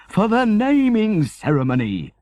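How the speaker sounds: high, loud jubilant reply. Acted. Father answering mother about the ceremony, jubilant and loud. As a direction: crow it out with joy. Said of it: "said Father, jubilantly".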